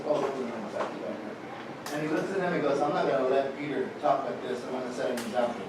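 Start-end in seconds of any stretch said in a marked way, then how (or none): 0.1-0.8 low, hushed objection; imitating a calm, low-voiced refusal; quiet refusal. Jesus reacting to Peter's rebuke. 2.8-5.6 low, soft musing aloud; understated, hushed determination; quiet resolve. Retold or imitated. Jesus privately deciding to rebuke Peter.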